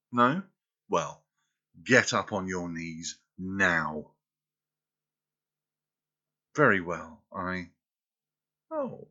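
loud, cold commands; stern dominant. Acted. Dominant lover scolding her disobedient girl, loud and stern. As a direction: snap into a strict mistress, scornful.

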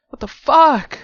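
explosive, high shocked yell. Acted. Man baffled that friend shrank, yelling. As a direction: burst out at full volume. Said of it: "I'm sorry I yelled".

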